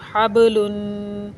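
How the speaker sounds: हबलुन with the बा bounced in a slow echoing release; qalqala on the ba. Shown as a demonstration. Teacher demonstrates qalqala in हबलुन, slow bounce on बा. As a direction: say हबलुन slowly, letting बा jolt and rebound. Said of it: "इसलिए इसको हिलाकर पढ़ा जाएगा".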